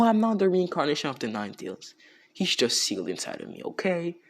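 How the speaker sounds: lightly voiced storyteller character laying it out; character explaining.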